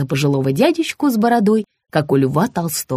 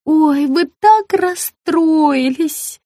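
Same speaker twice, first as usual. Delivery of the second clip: high, slow simpering; 11 semitones higher, 4 dB louder, much slower than usual. A narrator's reading. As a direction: simper over her. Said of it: "окончательно скоксилась".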